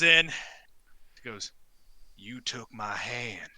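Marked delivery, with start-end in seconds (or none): 2.2-3.5 slow, lightly voiced wronged man; low, soft, drawn-out accusation; accusing. One-handed man confronts the gator, slow and accusing.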